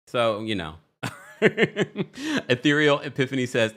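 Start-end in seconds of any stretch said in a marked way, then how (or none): 1.4-2.4 high bright burst of real laughter. Real, not acted. Laughing at her own joke about Portia, a real high laugh.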